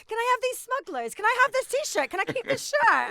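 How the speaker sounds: high, loud mimicked begging; mock pleading. Quoting man borrowing clothes.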